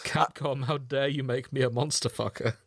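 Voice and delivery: ordinary talk, nothing marked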